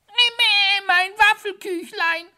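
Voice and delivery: very high, loud wail; a tiny hare howling from under the sofa. Acted. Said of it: "heulte es unter dem Sofa".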